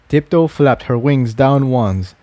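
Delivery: ordinary delivery, nothing marked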